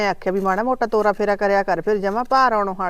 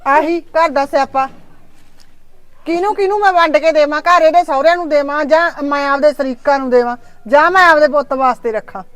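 distressed lament; 5 semitones higher, 5 dB louder than usual. Acted. Mother laments family property feud.

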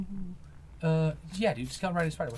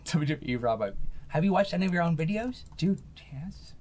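normal talking voice

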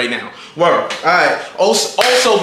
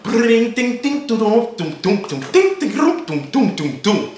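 bouncy sung-out ringtone mimicry; phone going off, done by mouth; imitated phone ringtone; 4 semitones higher than usual. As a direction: mimic a ringing phone with nonsense syllables. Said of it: "my phone goes off".

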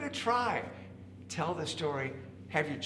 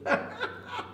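short high giggle; frog laughing. A narrator's reading. Frog joining in the laughter, high giggle. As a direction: let out a quick high chuckle. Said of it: "the frog started to laugh too".

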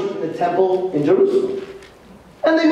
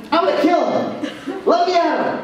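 put-on much higher, eager cocky boy; itching to fight the giant; eager boast; 10 semitones higher than usual.

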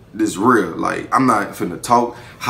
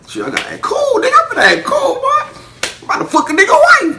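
high, loud laughing boast; mock cocky shouting with laughter; hyped brag; 8 dB louder than usual. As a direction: crow it like a proud rooster. Bragging to himself, hyped.

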